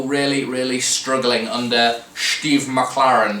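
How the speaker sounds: put-on slow, clumsy Dutch accent; Steve McClaren Dutch accent. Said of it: "the poor Dutch accent".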